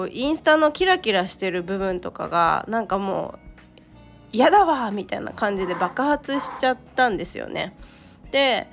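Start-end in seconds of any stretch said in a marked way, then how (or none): 4.4-4.9 sudden high, loud outburst of disgust; fed up. Retold or imitated. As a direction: blurt it out, sick of it all.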